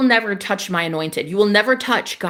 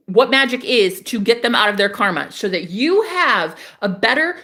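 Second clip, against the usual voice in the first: normal talking voice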